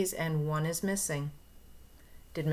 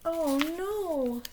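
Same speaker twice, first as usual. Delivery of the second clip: mock alarm; 10 semitones higher than usual. All monkeys gone.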